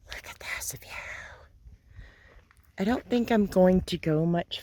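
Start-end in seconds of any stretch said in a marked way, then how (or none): 0.1-0.9 hushed, awed whisper. Real, not acted. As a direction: breathe it out in awe.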